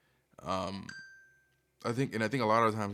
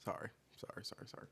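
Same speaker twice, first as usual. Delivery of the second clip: whispering; 14 dB quieter, faster than usual. Real, not acted. Quiet apology after lip comment.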